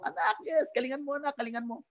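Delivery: lightly acted father yelling encouragement; father cheering his son at tryouts, high and light. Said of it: "sigaw na sigaw".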